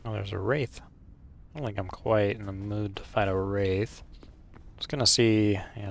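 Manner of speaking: normal talking voice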